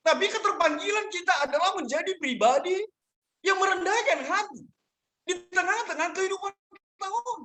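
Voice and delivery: high, insistent pulpit delivery; preaching. Acted. Pastor calling listeners away from ego, preaching.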